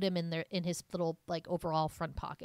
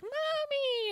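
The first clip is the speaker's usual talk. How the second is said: put-on very high little-kid cry; toddler impression. Playing the baby running to mom, high toddler voice. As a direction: imitate a small child calling out, pitched way up.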